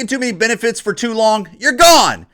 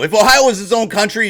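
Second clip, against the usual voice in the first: ordinary talk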